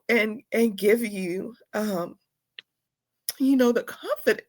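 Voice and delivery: usual speaking voice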